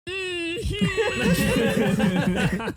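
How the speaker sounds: high, drawn-out knowing mm-hmm through a laugh; smug cartoon mm-hmm. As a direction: play a smug South Park character, arms folded. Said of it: "It was like a South Park character".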